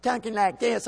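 high, mock little-kid talk; imitating affected boy. Dad imitating how his son came home talking, affected and high. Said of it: "came home talking one time, talking like this".

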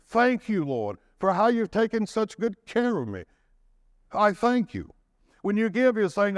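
lifted, ringing preacher's cry; preaching at full force. Acted. Preacher urging the congregation to give, ringing out. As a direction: preach it out to the church with rising fervour.

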